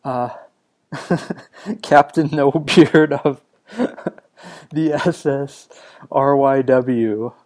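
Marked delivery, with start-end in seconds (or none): 0.9-7.3 laughing through a silly title, drawn out; amused laughing. Real, not acted. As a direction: repeat it slowly, cracking up at yourself. Laughs repeating his invented pirate name.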